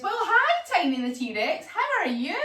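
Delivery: hi thrown very high and loud, then laughing; friendly shark greeting, hi up high; Shona the shark. Acted. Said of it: "Shona the shark says".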